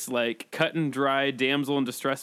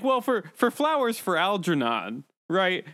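higher-pitched, stalling schoolkid; mock fifth grader; 4 semitones higher than usual. Fifth grader bluffing a report to the class, mock schoolkid. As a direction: fake your way through an unprepared report. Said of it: "you sounded like a fifth grader".

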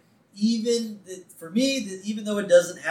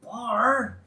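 high, protesting husband impression; mock-plead with your wife, pitched up; George; 5 semitones higher than usual. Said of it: "And George's like".

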